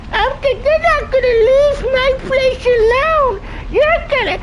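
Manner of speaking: very high squeaky mocking puppet voice; mock him in a shrill cartoon falsetto; Elmo. Streamer mocking the game's zesty dude, Elmo voice. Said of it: "he sound like El-, like Elmo".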